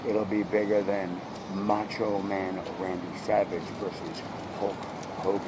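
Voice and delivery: low, very soft, confidential; hushed pitch. Retold or imitated. Bro pitching a televised fight to Trump, hushed. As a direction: drop way down, almost under the breath.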